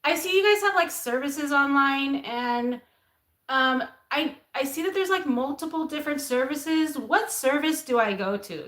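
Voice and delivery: put-on high, rising ditzy valley girl. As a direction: ask it like a clueless girl. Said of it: "This is how I used to talk".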